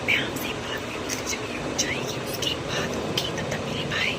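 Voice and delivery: real unvoiced whisper. Real, not acted. Mother to viewers beside sleeping baby, whispering. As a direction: whisper it so nobody wakes. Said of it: "मैं इतनी धीरे इसलिए बोल रही हूँ".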